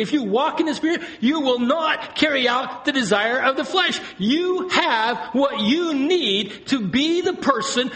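high-pitched, driving sermon climax; fervent exhortation to the congregation; impassioned preaching. Real, not acted. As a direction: preach it at full force, pitch held high. Preaches at full force.

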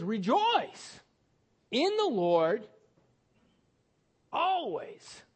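Rejoice in the Lord always, with always lifted high; drawn-out emphatic quote. Shown as a demonstration. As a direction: stretch the verse out, lifting always up high. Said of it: "He sa-, he says".

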